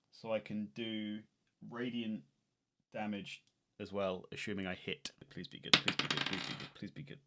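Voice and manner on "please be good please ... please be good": whispered pleading. Real, not acted. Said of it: "hoping for a good roll".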